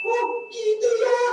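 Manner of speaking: put-on a small girl's dawning oh; little girl realizing. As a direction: puppet girl understanding. Caca realizing.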